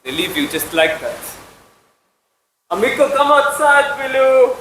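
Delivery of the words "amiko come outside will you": high, loud call to someone outside. Acted. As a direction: call her in from across the yard.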